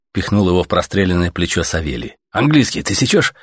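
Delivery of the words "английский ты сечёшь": high-pitched, eager nudge; excited realisation. A narrator's reading. Stalker nudging Artyom about English radio, excited. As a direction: push the discovery on your companion. Excited at hearing foreign station.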